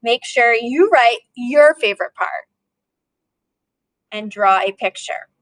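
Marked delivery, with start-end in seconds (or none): none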